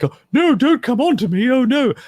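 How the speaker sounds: high, loud, whiny mock-panic voice; meek bumbling idiot. Mocking the meek character who squeals for help. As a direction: squeal it like a flustered pushover. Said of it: "the ones that are like".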